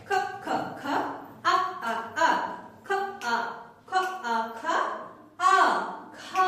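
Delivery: breathy, clipped খ repeated, then আ, blended into খা; teacher's drill of খ, আ, খা; phonics sounding-out. Shown as a demonstration. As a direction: chant খ and আ in separate beats, then join as খা. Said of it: "এভাবে করে আমরা বলবো".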